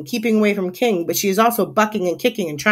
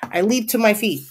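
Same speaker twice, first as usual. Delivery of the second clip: usual speaking voice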